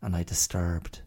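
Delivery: ordinary talk, nothing marked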